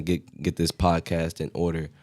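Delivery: ordinary talk, nothing marked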